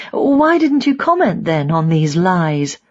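ordinary delivery, nothing marked

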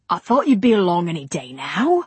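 put-on compelling voice with faint Cockney accent. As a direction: sell it a little too hard, Cockney. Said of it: "a voice that was just a little too compelling for private life".

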